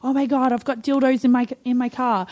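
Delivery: mock panic over the car search; panicked friend. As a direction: imitate a friend freaking out at a police stop. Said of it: "My girlfriend's freaking out, going".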